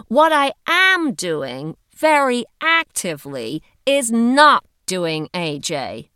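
"am" and "not" punched loud and slow; emphatic insistence. A narrator's reading. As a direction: stretch and hit "am", "actively" and "not". Heidi emphatically corrects herself.

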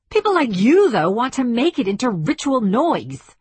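high-pitched, heated outburst; snapping angrily. A narrator's reading. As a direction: lose patience and lash out at an opponent. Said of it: "I'm sorry I snapped".

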